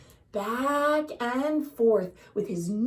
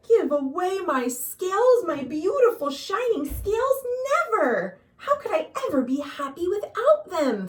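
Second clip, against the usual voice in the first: higher, louder, outraged refusal; indignant rainbow fish; 7 semitones higher, 4 dB louder than usual. A narrator's reading. Rainbow fish protesting the octopus's advice, indignant and higher. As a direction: clutch your treasure and refuse.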